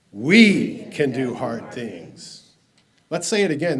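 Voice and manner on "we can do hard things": WE drawn out and loud, the rest falling away; stress on WE. Shown as a demonstration. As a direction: lean long and hard on "We". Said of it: "put the emphasis on the we".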